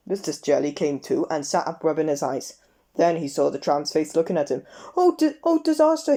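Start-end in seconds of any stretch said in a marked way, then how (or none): 5.0-6.2 high, loud screamed panic; terrified scream. A narrator's reading. Mr Jelly waking to the tramp's face, high loud scream. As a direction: scream it in terror. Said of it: "he screamed".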